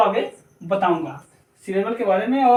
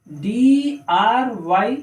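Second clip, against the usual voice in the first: very slow, drawn-out letters; one letter at a time; spelling out; much slower than usual. Shown as a demonstration. Teacher spelling dry for learners, slow letters.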